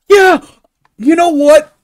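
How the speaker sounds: loud, high burst of realization; sudden realization. Real, not acted. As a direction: it just clicked, blurt it out.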